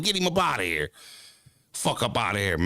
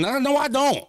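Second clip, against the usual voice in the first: sharp protest; 11 semitones higher than usual. Real, not acted. Streamer refuses chat's order to calm down, sharp and high. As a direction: snap back a high indignant no.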